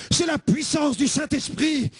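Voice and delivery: slow, high, emphatic declaration; fervent proclamation. Retold or imitated. Son answers his mother, slow fervent shout. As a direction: proclaim it with full conviction. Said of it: "Non, maman".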